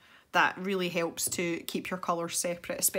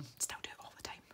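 quick whispered admission; hushed confession; 17 dB quieter, faster than usual. Real, not acted. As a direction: whisper it fast, under your breath. Same admission repeated under breath.

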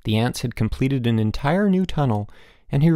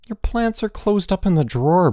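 high, exasperated yell; 9 semitones higher than usual. A narrator's reading. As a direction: yell back at a roommate. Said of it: "Bruno yelled".

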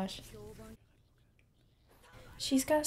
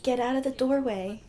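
louder and louder, get out of the doorway; urgent yelling at screen; 3 semitones higher, 6 dB louder than usual. Real, not acted. Viewer shouting at a character on screen, urgent.

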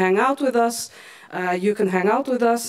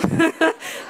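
embarrassed laugh; 6 semitones higher than usual. Real, not acted. Host laughs thanking helper.

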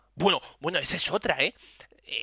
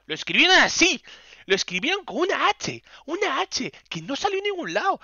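much higher, loud indignant squeal; outraged disbelief; 13 semitones higher, 5 dB louder than usual. Real, not acted. Streamer tells viewers about her misspelled name in high outrage. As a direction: shriek your outrage at the stray letter.

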